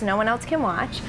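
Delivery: ordinary delivery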